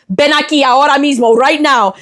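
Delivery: high mock scolding in a Spanish accent; screaming Spanish mom. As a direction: order the kid over like an angry Spanish-speaking mom. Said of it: "my mom starts screaming".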